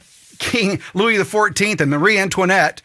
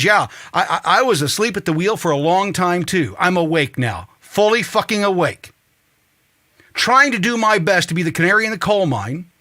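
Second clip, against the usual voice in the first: usual speaking voice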